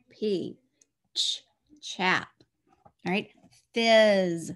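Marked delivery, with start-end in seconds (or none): 0.1-2.3 unvoiced whispered "p, p, p, ch, chap"; whispered sounding out. Shown as a demonstration. Teacher whispering sounds while a child writes. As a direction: breathe each sound, then whisper "chap". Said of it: "Let's write it".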